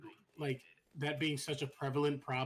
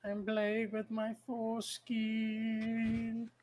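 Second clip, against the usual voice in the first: silly voice; 7 semitones higher, much slower than usual. Pretend. Silly put-on voice, friends laugh.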